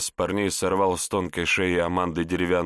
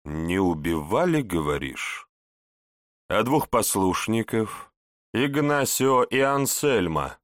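insinuating; 3 semitones higher than usual. Acted. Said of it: "вкрадчиво спросил".

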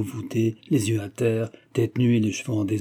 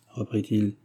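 plain reading voice

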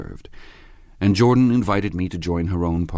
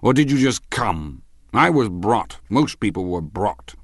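loud, higher-pitched tipsy chatter; raised, insistent drunken voice; drunken man; 4 semitones higher, 5 dB louder than usual. A narrator's reading. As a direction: hold forth emphatically like a drunk. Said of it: "sitting somewhat drunk".

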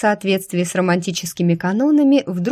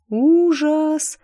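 high, drawn-out gasp of horror; 10 semitones higher than usual. Acted. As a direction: stretch it out, appalled. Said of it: "протянула я".

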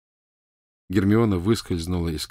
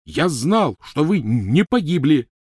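high, loud, drawn-out protest; forceful, raised-voice bellow; roaring back; 8 semitones higher, 6 dB louder, slower than usual. Acted. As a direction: outshout her angrily. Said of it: "рявкнул Рон, впервые перекричав её".

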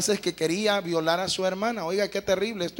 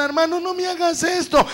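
much higher, desperate plea; pleading cry; 7 semitones higher than usual. Retold or imitated. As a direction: beg in terror. Víctima suplicando. (súplica aguda y desesperada)

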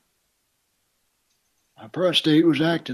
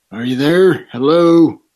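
shouting into a phone; 2 semitones higher, 11 dB louder, slower than usual. Acted. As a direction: yell hello into the receiver. Shouts into silent phone line.

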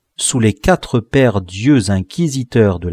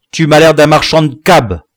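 loud, higher-pitched reproach; cross, raised-voice jibe; scolding father; 5 semitones higher, 8 dB louder than usual. A narrator's reading. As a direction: tell off a scruffy son. Father scolds untidy son.

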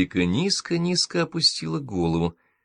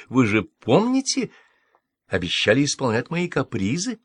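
coaxing, higher-pitched playful question; 5 semitones higher than usual. A narrator's reading. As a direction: coax with a teasing lilt. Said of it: "Тон Эвридики сделался игривым".

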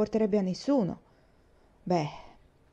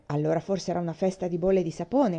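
plain reading voice

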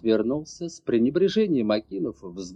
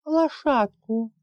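put-on high, slow, small child's voice; timid little girl asking for a pony; whimpering child, holding back tears; girl babbling softly; 10 semitones higher, slower than usual. A narrator's reading. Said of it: "тихо пролепетала девочка".

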